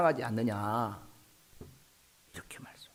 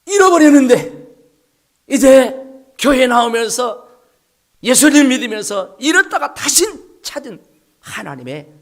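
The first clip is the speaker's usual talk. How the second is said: loud, higher, fervent proclamation; preaching at full force; 8 semitones higher, 14 dB louder than usual. Real, not acted. The pastor to his congregation, proclaiming at full force. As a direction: lift the congregation with a ringing cry.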